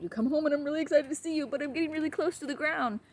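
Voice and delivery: excited dog voice. Acted.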